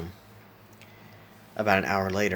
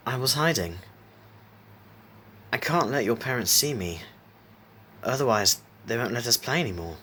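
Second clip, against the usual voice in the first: usual speaking voice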